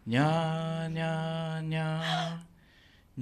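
drawn-out slow nya humming; slow motion. Shown as a demonstration. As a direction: stretch each nya out slowly. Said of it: "ゆっくり行ってみてください".

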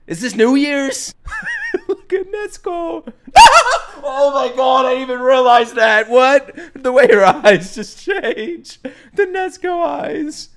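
high, loud, laughing squeals; giddy laughter. Real, not acted. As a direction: squeal with delight at the screen. Cracking up at Nezuko.

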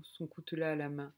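plain reading voice

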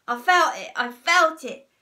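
loud, rising agitated shout; worked-up storybook shout. A narrator's reading. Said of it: "shouted Mister Twit".

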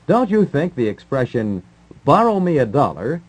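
normal talking voice

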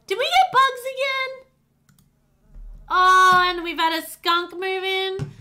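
high, loud disbelief; exasperated shock. Real, not acted. Player discovers bugs and a skunk, high exasperated disbelief. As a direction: cry out as the pests return.

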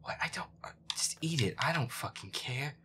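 usual speaking voice

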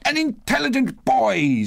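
high, gleeful praise; delighted Scrooge. A narrator's reading. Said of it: "said Scrooge".

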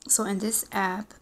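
ordinary talk

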